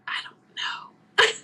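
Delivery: slow, whispered admission of being lost; hushed, drawn-out retold answer; bewildered whisper. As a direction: whisper it slowly, at a loss.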